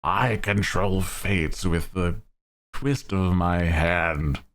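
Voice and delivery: slow, grand villain boast. Pretend. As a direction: declare it like an anime overlord. Mock anime villain line.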